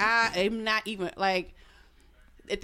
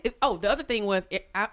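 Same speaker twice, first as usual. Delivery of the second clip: usual speaking voice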